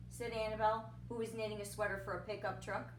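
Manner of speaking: ordinary delivery, nothing marked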